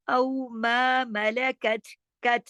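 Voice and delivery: slowly recited औ मा मलकत, nothing made heavy; light consonants in मलकत, long मा; thin letters. Shown as a demonstration. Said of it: "मोटा करके नहीं पढ़ना।". (मलकत को बारीक पढ़ते हुए)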